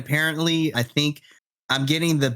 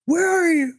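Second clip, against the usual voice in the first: high, pouty whine; high whiny texting voice; 3 dB louder than usual. Retold or imitated. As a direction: pout it like a needy friend. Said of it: "I was just like".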